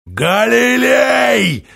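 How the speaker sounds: long, drawn-out high yell; urgent shout through a tube. Acted. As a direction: call down the tube, alarmed. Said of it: "По голосу Дорофеева догадался, что ситуация хуже".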